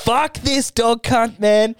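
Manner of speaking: high, drawn-out disgusted outburst; acted outrage. Host reacting to man leaving his dog, acted outrage.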